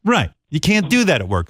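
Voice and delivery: normal talking voice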